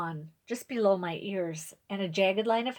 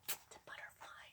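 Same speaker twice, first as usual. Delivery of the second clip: whisper; 24 dB quieter than usual. Shown as a demonstration. Reader answers kids in aside.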